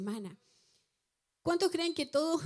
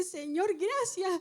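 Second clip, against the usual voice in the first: tearful thanks; 7 semitones higher, much slower than usual. Retold or imitated. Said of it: "terminé llorando".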